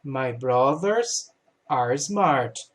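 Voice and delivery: teacher modelling the corrected sentence slowly; slow, careful English model line. Shown as a demonstration. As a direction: read it out slowly, word by word. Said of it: "Vai ser o are".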